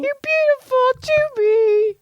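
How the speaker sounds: very high, slow squeaky praise; hammed-up cooing compliment, voice way up; mock gushing. Joy reassures co-host who looks trashy, mock gushing.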